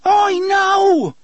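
loud, high-pitched desperate scream; high panicked yell. A narrator's reading. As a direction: scream it out to stop him. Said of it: "he screamed".

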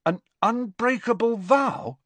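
high, astonished question; stunned disbelief. A narrator's reading. Ron hearing of the vow, stunned. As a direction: echo it back high, amazed. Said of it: "looking stunned".

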